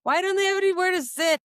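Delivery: high, fast cranky complaining; grumpy old person voice. Pretend. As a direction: play a fussy old person griping. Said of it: "sound like an old person".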